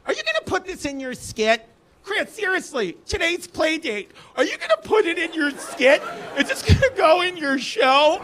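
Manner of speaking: high-pitched, put-on mom voice; mock playdate mother. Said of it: "and then the mother".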